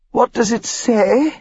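slow, pained moan; a hint of a hurt mother. A narrator's reading. Injured mother asking about letter, moaning.

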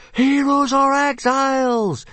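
very high, stretched-out war cry; crowd yell. A narrator's reading. Watching tribes echo the rallying cry, high yell. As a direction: shout it like a whole watching tribe. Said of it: "yelled the watching hooligan and meathead tribes".

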